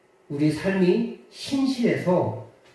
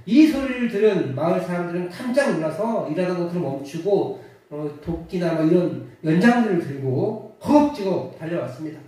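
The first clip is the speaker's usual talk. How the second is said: ordinary talk, nothing marked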